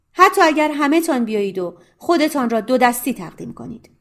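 تهدید پرخشم، بلند و بالا; furious rant. Acted. Accountant's furious threatening rant.

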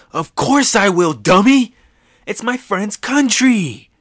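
high, loud, fierce yell. Retold or imitated. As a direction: shout the promise at full strength.

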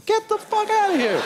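very high-pitched, incredulous outburst; hammed-up disbelief, voice shooting up; exasperated disbelief. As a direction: dismiss it with squeaking exasperation. Exasperated reaction to cousin's idea.

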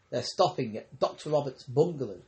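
plain reading voice

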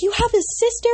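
high, thrilled gasp of a question; excited squeal. Retold or imitated. Child learning about a sister, thrilled disbelief. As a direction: squeal it like a kid hearing great news. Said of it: "And I was so excited".

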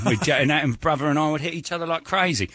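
imitating a Cockney brawler; Cockney impression. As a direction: tell it like a Cockney fighter. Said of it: "Oh, the Cockney guys".

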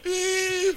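high, thin imitated wail; soft put-on whine of weeping; mock crying. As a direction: fake a drawn-out sob. People were crying.

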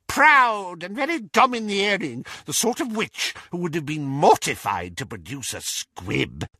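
high, emphatic gossiping aunt; Auntie Muriel, disdainful. A narrator's reading. Auntie Muriel judging Kendra, disdainful.

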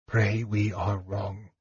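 slow, soft and breaking; barely holding the voice together; soft broken voice. A narrator's reading. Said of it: "he spoke softly, in a broken voice".

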